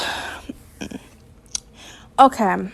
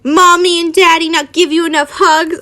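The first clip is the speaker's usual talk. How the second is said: mock baby-talk taunt, higher; sneering; 5 semitones higher, 4 dB louder than usual. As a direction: taunt him with fake pity. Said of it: "Harry sneered".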